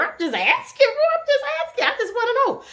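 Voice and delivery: very high, sing-song innocent voice; innocent mom. Retold or imitated. Mom answering her kids, high and innocent. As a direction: play it sweet and innocent. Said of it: "I'm just, girl".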